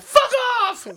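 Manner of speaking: very high, loud hammed-up "fuck off"; mock angry shout. Playing a mad thick girl storming off, shrill mock yell. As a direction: squeal it like a huffy girlfriend.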